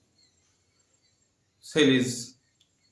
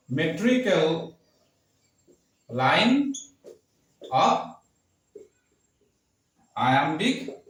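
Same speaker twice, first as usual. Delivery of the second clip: each word spaced out slowly for note-taking; long pauses between words, like writing on a board; slow dictation; 4 semitones higher than usual. Shown as a demonstration. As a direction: dictate the definition word by word, pausing as you write. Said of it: "let me write first the definition".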